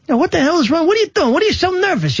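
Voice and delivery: high-pitched wisecracking hothead voice; Joe Pesci. Acted. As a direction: needle them in a high, agitated gangster voice. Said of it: "especially when I'm doing Joe Pesci".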